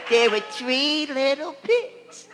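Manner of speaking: very slow, drawn-out fairy-tale voice; put-on bedtime-story delivery, hammed up; exaggerated storyteller. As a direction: stretch it out like a theatrical storyteller. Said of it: "tell you a story of the three little pigs".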